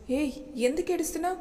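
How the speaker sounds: a husband suddenly worried at her tears; alarmed. Acted. Husband sees wife crying, asks in alarm. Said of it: "కంగారుగా అడిగాడు".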